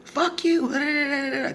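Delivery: very high-pitched retold cursing; mock screaming. Pinned girlfriend refusing to give in, mock screaming. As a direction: mimic a screechy tirade without full volume. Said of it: "Kept screaming".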